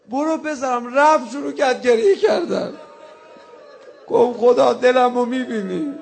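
loud wailing mourning chant, voice breaking; rowzeh lament at full force. Acted. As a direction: cry out the lament to God, sobbing.

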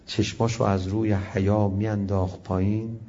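normal talking voice

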